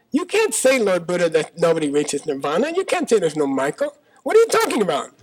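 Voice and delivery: exasperated outburst, pitch leaping up; incredulous protest. Acted. Disciple arguing with Lord Buddha over Michael, incredulous.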